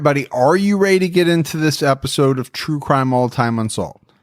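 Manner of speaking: ordinary talk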